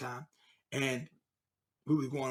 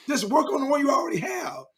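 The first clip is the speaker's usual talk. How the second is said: emphatic shout; 9 semitones higher than usual. Real, not acted. To the hosts, shouting the moral of his marriage story.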